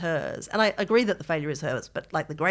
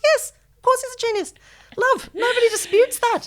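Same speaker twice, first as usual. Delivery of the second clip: high, sing-song mock reassurance, louder; mock soothing; 6 dB louder than usual. To crying Orwell fans, a high patronising mock comfort. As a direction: pat them on the head with your voice. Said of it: "I'm like".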